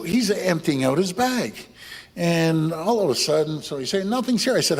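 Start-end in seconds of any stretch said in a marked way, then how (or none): 4.1-4.6 high-pitched protest; thief's denial. Retold or imitated. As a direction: squeak out the caught thief's innocence.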